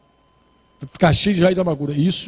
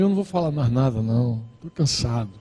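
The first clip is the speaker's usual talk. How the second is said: softer, lower, tired inner voice; saying it to himself, worn out; weary self-talk; 6 semitones lower, 5 dB quieter than usual. Retold or imitated. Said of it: "já tava dizendo pra mim". (voz cansada e baixa, falando consigo mesmo)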